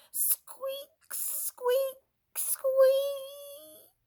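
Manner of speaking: very high squeaking sound effect; squeaky marker. A narrator's reading. Highlighter marking paper.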